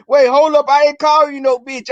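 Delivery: high, loud defensive backpedal; alarmed protest. Retold or imitated. Boyfriend protesting loudly that he never insulted her. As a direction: throw up your hands and deny it.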